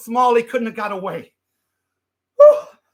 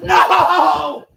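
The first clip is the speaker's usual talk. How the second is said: alarmed shout; 7 semitones higher than usual. Acted. Elvis vanishes, speaker yells.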